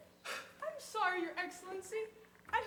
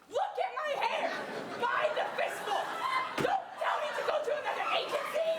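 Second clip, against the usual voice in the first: higher, louder half-laughing outburst; frantic laughing rant; 6 semitones higher, 4 dB louder than usual. Acted. Desperate woman shows the banker her hair, frantic laughing. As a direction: laugh wildly as you show your hair.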